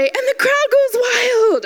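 high, slow, hyped-up call; crowd goes wild. Shown as a demonstration. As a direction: call out the audience cue, drawn out. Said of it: "or I'll say".

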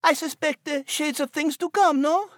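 a tiny chatty creature musing; Nigel the mouse. A narrator's reading.

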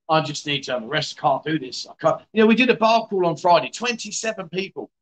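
normal talking voice

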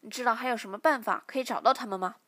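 loud, fast threatening question; menacing interrogation; glaring demand. Acted. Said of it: "队长瞪着小柔说".

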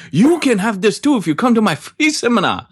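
very high mock Vietnamese accent; Tom Vu. Host joins the guru parody, high-pitched Vietnamese accent. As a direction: sell the seminar in a squeaky, bad Vietnamese accent. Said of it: "say it with a really bad accent".

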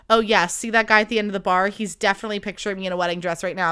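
fast, breathless fantasizing; imitating her own giddy bar fantasy; rushed daydream. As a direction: rattle it off, carried away. Retelling own hopeless romantic fantasy.

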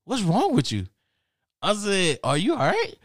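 high-pitched acted bewilderment; baffled questioning. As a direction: demand it in a high, puzzled voice. Narrator acts out questioning laughing roommate.

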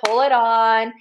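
normal talking voice

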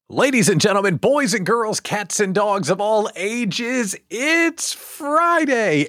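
big booming showman announcement; ringmaster. Acted. Host opens show like announcer.

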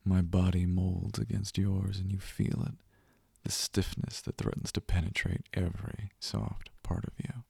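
ordinary talk, nothing marked

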